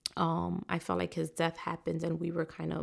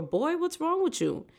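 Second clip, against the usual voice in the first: scolding elder; 10 semitones higher than usual. Retold or imitated. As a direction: snap at a girl like a scolding elder. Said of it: "do you hear someone say".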